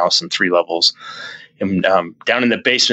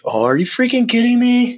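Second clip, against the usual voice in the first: retold disbelief; 14 semitones higher than usual. Retelling being told of a spirit, high incredulous. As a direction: replay your shock, pitch shooting up. Retelling his shocked reaction to her.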